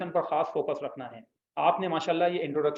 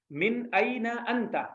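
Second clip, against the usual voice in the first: slow, drawn-out, softer Arabic phrase; slow model reading; 4 semitones higher, 4 dB quieter, much slower than usual. Shown as a demonstration. Teacher models the phrase slowly for students to repeat. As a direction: say मिन अइन अंता slowly for the class to repeat. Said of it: "बोलिए".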